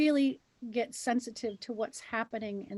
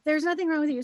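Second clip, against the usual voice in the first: loud reassurance; 5 semitones higher, 9 dB louder, faster than usual. Real, not acted. Loud reassurance amid laughter.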